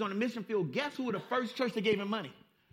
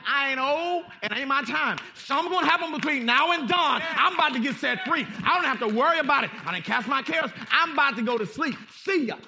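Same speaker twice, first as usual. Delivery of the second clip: Peter, carefree; 4 semitones higher, 9 dB louder than usual. Retold or imitated. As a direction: voice Peter shrugging off danger, loud. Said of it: "Peter sleep is like".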